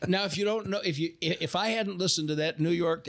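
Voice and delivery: usual speaking voice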